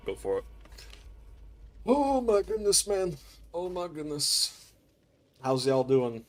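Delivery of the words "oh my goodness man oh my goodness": amazed exclaim. Real, not acted. Gameplay surprise, drawn out.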